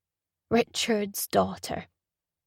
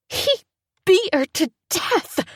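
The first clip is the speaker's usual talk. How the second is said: hissed fury; 10 semitones higher, 4 dB louder than usual. Acted. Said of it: "I hiss".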